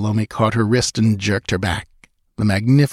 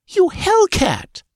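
outraged cry; 4 dB louder, slower than usual. Acted. Outraged queen insults sister.

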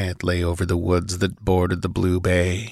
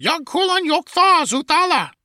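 much higher, far-off squawking bird voice; harsh parrot cry. A narrator's reading. Ancient parrot cries out at the invaders. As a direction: screech the strange words like a parrot. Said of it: "cried out harshly".

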